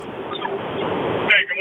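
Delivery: distant, off-mic caller; from across the room. Real, not acted. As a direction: voice far from the phone. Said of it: "you sound like you're halfway across the room".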